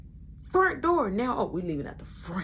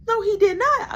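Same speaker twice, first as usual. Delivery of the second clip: shocked disbelief; 12 semitones higher, 7 dB louder than usual. Retold or imitated. Shocked at slammed door.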